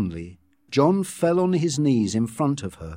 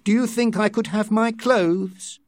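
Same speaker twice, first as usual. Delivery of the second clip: higher-pitched request through a door; lightly raised voice from another room; calling out; 9 semitones higher than usual. A narrator's reading. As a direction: call out a tentative request. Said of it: "called from the bathroom".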